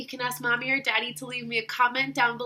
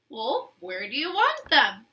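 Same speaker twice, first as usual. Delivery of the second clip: plain reading voice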